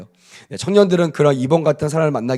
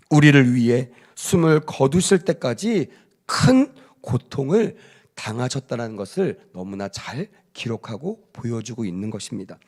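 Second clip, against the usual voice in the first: ordinary delivery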